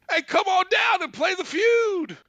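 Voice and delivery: high, loud host's catchphrase shout; game show slogan. Retold or imitated. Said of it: "'Cause that was his slogan".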